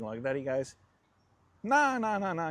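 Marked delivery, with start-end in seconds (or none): 1.6-2.5 quick high-pitched no, no, no, no; emphatic refusal. Retold or imitated. Mate refusing wrapping, retold.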